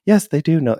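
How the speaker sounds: hammed-up insistent inner voice; mock insistent voice. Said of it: "be like".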